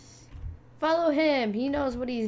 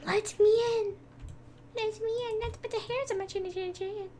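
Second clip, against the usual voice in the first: high hammed-up wolf voice; Big Bad Wolf voice; 11 semitones higher, faster than usual. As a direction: play the pig's squeaky reply.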